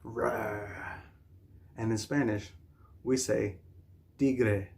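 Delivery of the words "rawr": soft, low, drawn-out rawr; a gentle pretend tiger growl; mock tiger roar. Imitates tiger for children.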